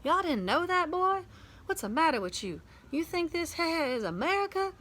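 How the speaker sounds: put-on Southern-style accent. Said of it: "encouraged Dad to say some more Southern-style stuff".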